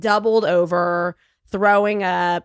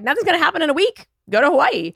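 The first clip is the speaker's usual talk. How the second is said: breezy doctor; 9 semitones higher, 4 dB louder, faster than usual. Retold or imitated. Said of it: "my doctor was like".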